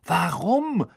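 shouted together in surprise; calling out. A narrator's reading. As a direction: cry out as one. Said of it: "riefen alle im Chor".

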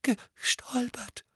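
high, slow, trembling; shaky. A narrator's reading. Dudley answers his father, shaky. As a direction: quaver the word. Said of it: "sagte Dudley zittrig".

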